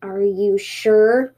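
very slow, drawn-out suspicious question. Acted. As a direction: stretch the question out with doubt. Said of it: "said the tanuki suspiciously".

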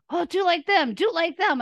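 mocking the advice. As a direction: parody the people who tell you to copy others. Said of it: "but I hate".

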